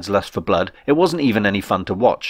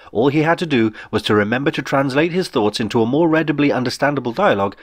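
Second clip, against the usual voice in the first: ordinary delivery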